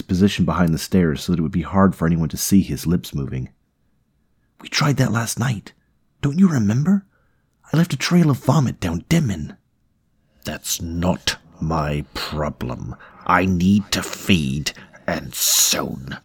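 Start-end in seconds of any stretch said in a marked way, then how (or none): 4.6-9.6 high-pitched, exasperated reminder; exasperated retort. Acted. Host reminding his parasite about last night, exasperated. 10.4-16.2 very slow, deliberate parasite voice; demanding parasite. Acted. Parasite to reluctant host about feeding, slow and demanding.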